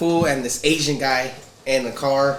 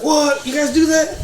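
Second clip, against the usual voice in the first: much higher mock surprise; surprised Justin; 11 semitones higher than usual. Coworker surprised to hear about the podcast, high mock surprise. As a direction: act out a coworker's disbelief. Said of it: "Justin's like".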